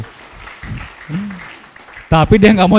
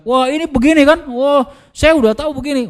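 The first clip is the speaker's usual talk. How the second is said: impatient know-it-all; 8 semitones higher than usual. Retold or imitated. Prospect interrupting salesperson eagerly.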